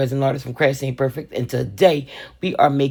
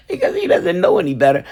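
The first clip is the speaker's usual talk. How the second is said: laughing; 4 dB louder, faster than usual. Real, not acted. Mother explaining her toddler's innocence, laughing through it.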